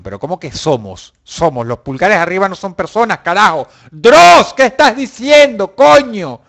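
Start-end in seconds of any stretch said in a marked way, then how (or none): 3.9-6.4 high, loud mock scolding of himself; self-scolding shout. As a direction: yell at yourself like a fed-up critic. Se regaña a sí mismo gritando. (regañándose a sí mismo a gritos, agudo y fuerte)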